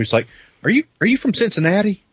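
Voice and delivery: ordinary delivery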